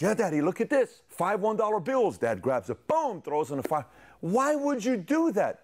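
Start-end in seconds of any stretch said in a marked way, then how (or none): 0.0-2.2 put-on high, loud excited kid voice; proud son. Son shows dad the begged money, proudly excited. 4.2-5.6 put-on very high, drawn-out child's protest; upset son. Son watches dad burn his money, protesting.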